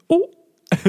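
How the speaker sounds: a sudden squeaky crack in the voice. Real, not acted. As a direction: voice cracks up high mid-word. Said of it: "my voice cracked there".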